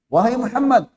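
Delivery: loud, high rough call to the Prophet. Acted. Bedouin calling the Prophet by name, loud and rough. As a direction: call out bluntly, demanding.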